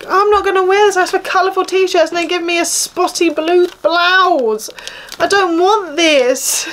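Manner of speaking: rising, indignant refusal; outraged. Real, not acted. Rejecting a spotty blue blouse, high and indignant. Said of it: "I could cry".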